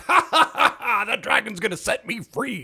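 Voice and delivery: high, hammed-up evil laugh into the line; mock villain cackle and gloat. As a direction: cackle like a mad wizard, then gloat. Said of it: "he's like".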